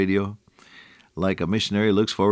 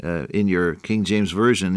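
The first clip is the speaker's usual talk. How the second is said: ordinary talk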